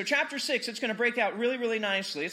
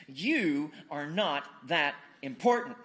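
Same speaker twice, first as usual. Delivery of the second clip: slow, low and deliberate, word by word; "You are not that important" spaced out with weight; pointed reading; 6 semitones lower, slower than usual. Acted. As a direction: let each word land separately, pitched down. Savours a favourite verse sentence.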